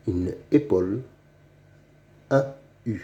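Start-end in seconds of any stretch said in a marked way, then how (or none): none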